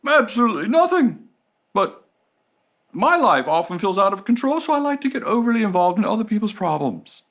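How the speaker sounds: high, eager busybody rescuer. Retold or imitated. Rescuer cheerfully butting into others' problems. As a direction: chirp it like a meddling helper. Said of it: "rescuer says".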